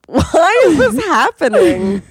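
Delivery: high, slow, loud baffled whine; baffled listener. Pretend. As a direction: squeal it in confusion, drawn out. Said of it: "people are gonna be in their cars being like".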